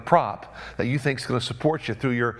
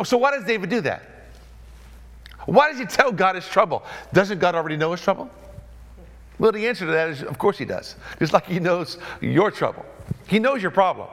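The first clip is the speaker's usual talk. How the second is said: ordinary talk, nothing marked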